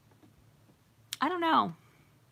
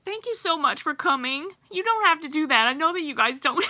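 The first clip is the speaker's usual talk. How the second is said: higher and louder, moved thanks; touched thanks; 7 semitones higher, 4 dB louder than usual. Real, not acted. Thanking her sister for coming, touched and gushing. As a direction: gush out thanks, a little overwhelmed.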